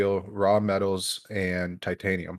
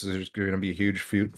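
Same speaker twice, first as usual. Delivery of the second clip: ordinary talk, nothing marked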